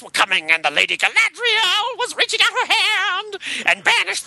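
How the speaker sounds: high, screeching metal singer narration; howling metal storyteller voice; heavy metal screechy voice. Acted. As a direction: belt it like a metal frontman wailing an epic. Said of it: "heavy metal screechy voice".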